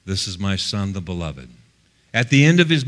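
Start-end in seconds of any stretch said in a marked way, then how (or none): 0.0-1.5 low, hushed voice from heaven; voice of God. Retold or imitated. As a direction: quietly voice the Father, pitched low. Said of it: "he heard the voice say".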